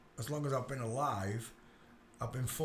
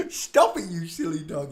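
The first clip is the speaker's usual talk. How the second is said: loud telling off; 3 semitones higher, 11 dB louder than usual. Real, not acted. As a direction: shoo the dog off. Dog jumps on him.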